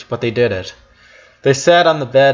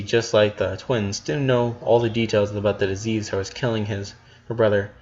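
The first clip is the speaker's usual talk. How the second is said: plain reading voice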